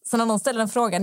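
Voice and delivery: usual speaking voice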